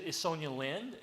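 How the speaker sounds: ordinary talk, nothing marked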